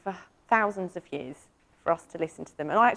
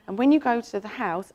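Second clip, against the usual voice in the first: normal talking voice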